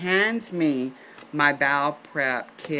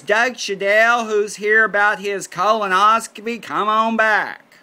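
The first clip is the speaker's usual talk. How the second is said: nurse calling out; 5 semitones higher, 5 dB louder than usual. Retold or imitated. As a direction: holler a patient's name across the room. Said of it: "she goes".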